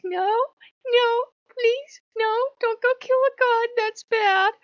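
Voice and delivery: very high, pleading Loki voice; Loki begging. Retold or imitated. Loki begging her not to kill a god, high-pitched. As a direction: squeak out a frantic plea. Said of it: "And he's like".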